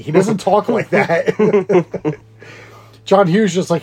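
normal talking voice

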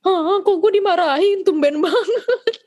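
high, laughing "why am I being scolded"; laughing disbelief. Retold or imitated. Daughter stunned at being scolded, laughing high. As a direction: laugh through the surprised protest, slowing at the end. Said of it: "Terus gue kayak".

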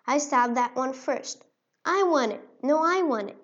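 squabble like greedy mice; squabbling. Acted. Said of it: "squabbling over them".

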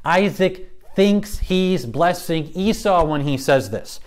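ordinary talk

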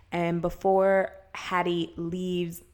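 ordinary delivery

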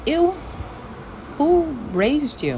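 high, slow, drawn-out disgust. Real, not acted. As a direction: recoil at the rudeness. Disgusted at bad attitudes.

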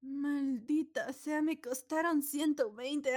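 drawn-out slow lament; slow exasperated curse. Retold or imitated. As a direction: stretch it out slowly, annoyed. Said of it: "¿Y tú?". (lamento lento y exasperado)

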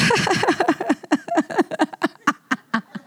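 high, stuttering imitated laugh; long run of tearful cackles, dying away; crying into laughing. Speaker acting out her breakthrough to congregation, crying turned laughing. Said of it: "I went from crying to".

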